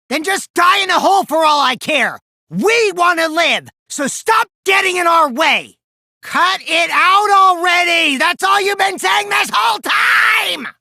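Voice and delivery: high, loud, slowed shouting; shouting at a rival who won't stop; furious shout. Acted. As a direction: scream him down. Heated shouting in trial argument.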